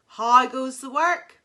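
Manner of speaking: loud bossy farmer call; lazy farmer bellowing from bed. Acted. Farmer calling, repeated.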